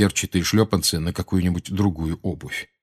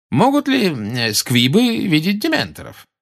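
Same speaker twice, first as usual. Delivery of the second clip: inquisitive; 10 semitones higher, 3 dB louder than usual. A narrator's reading. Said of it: "поинтересовался он".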